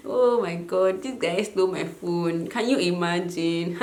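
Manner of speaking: laughing high to keep from crying, half-hysterical; crazy nervous laughter. Retold or imitated. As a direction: laugh it off so you won't cry. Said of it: "that crazy laughter 'cause you don't want to cry".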